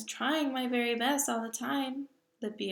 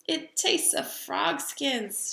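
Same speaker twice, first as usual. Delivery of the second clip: gasped; 6 semitones higher, 6 dB louder, much slower than usual. Acted. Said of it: "she gasped".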